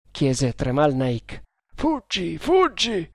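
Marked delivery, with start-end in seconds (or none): 1.7-3.1 high-pitched urgent warning; crying out. A narrator's reading. Nagor warns Tremal Naik to flee, crying out. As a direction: cry a warning high, without much volume. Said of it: "gridò Nagor".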